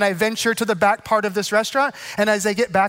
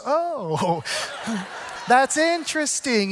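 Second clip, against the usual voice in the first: awkward polite brush-off; 2 semitones higher, much slower than usual. Retold or imitated. People react to pastor.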